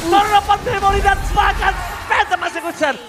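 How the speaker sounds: rising hype call; excited play-by-play. Real, not acted. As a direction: call the combo with building energy. Live play-by-play surge.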